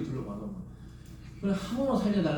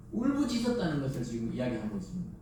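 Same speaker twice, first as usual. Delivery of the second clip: normal talking voice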